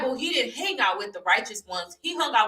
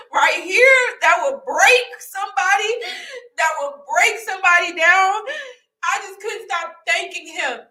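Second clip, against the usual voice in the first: much higher and louder, pushing each phrase out; emphatic testimony; 9 semitones higher, 6 dB louder than usual. Real, not acted. Telling viewers her hardship would break somebody, impassioned and loud. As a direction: testify with full force.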